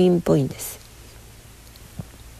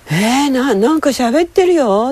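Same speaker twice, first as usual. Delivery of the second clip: surprised; 7 semitones higher than usual. Retold or imitated. Retold surprise at talking fridge.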